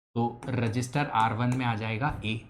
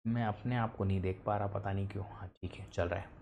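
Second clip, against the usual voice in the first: low, much softer aside; quiet aside; 7 semitones lower, 12 dB quieter, faster than usual. Real, not acted. Teacher puzzling over why the live stream stalled, quiet aside. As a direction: drop out of lecture voice, low and soft.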